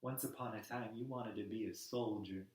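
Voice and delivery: soft, low, halting storytelling; gentle appeal. Acted. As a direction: lead him in quietly, pausing between phrases. Gently addressing Bruce with a story.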